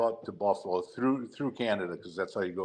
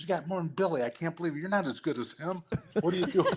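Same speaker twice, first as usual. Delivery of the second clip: incredulous wife; 7 semitones higher, faster than usual. Retold or imitated.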